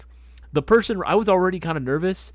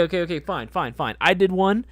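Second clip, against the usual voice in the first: ordinary talk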